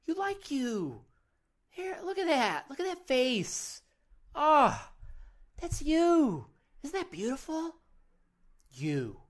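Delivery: high, loud adoring coo; gushing delight. Pretend. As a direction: gush over the face in the mirror. Gushing at one's reflection in mirror.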